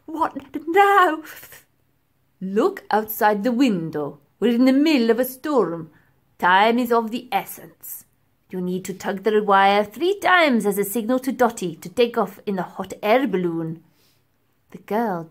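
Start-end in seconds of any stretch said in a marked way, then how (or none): 0.0-1.2 put-on higher, louder girl calling; girl's voice. A narrator's reading. Girl asks from tank. 2.4-13.8 demanding professor. A narrator's reading. Professor gives orders.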